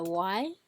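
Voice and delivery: "why" as a rising second tone. Shown as a demonstration. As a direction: lift "why" like a question. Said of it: "pretty much equals the second Chinese tone".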